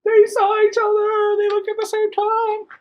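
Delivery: very high, loud excited reader; excited shout. Real, not acted. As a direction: reader thrilled by the big moment. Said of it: "reader's excited reaction".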